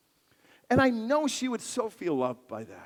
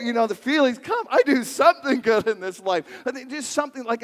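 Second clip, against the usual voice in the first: ordinary talk, nothing marked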